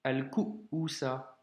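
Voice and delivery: slow "al-ku'usa", long stop after "ku"; two-part demo of "al-ku'usa"; hamza blocked. Shown as a demonstration. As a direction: cut "ku" short, wait, then "usa". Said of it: "Blocage encore".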